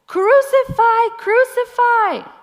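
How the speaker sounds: high, loud shouted demand; crowd shouting. Acted. Preacher voicing the chief priests demanding crucifixion, shouting. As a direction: scream it like a mob. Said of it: "they shouted".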